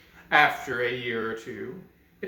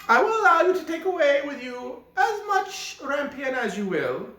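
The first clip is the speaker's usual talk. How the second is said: high, wavering witch voice bargaining; a witch striking a sly deal; softened enchantress; 10 semitones higher, 4 dB louder than usual. Acted. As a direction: witch demanding the child, high and strange. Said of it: "allowed her anger to be softened".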